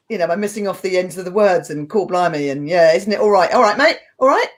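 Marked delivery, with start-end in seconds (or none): 1.9-4.5 matey Cockney imitation, lilting up at the end. Shown as a demonstration. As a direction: drop word endings like a Cockney. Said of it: "I get a bit Cockney".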